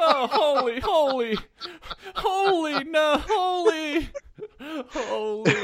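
high, loud laughing cry of holy; mock anguished wail dissolving in laughter. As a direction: wail holy while cracking up. Mock wailing holy through laughter.

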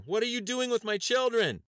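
mother screaming at the agents; desperate scream. A narrator's reading. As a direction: scream in panic for the children. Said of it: "she screamed again".

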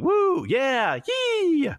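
very high, loud whooping yell; hammed-up victory yell; mock battle whoop. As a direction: whoop wildly while tearing through enemies. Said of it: "while going".

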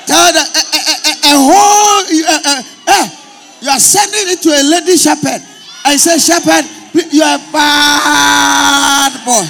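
high, shouted sermon rebuke; loud, high-pitched preacher's scolding; full-force preaching. Real, not acted. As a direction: shout it to the congregation, stretching key words.

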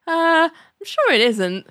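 unconvincing. Retold or imitated. John half-heartedly reassuring Gilbert, slow and unconvinced. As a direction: say it without believing it.

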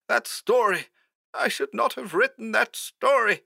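very high, soft, strained voice; frightened high voice, losing hope; hoarse, despairing. A narrator's reading. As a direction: confess helplessly in a thin, high pitch. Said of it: "more hoarsely this time".

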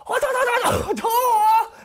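high, loud jeering laugh; mocking cackle. The truck guys jeering as they drive off, high loud laugh. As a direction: cackle like you just got away with it.

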